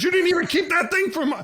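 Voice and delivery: hammed-up shocked wife scolding father; mock disbelief. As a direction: act aghast that he binned it. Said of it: "And he goes".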